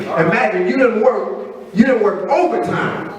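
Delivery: ordinary delivery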